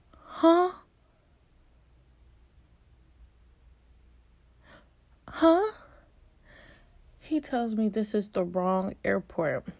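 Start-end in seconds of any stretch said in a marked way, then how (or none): 0.1-5.7 high, startled huh of disbelief; stunned huh. Retold or imitated. Told by an agent she booked the wrong airport, high shocked huh. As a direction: react like the news just hit you.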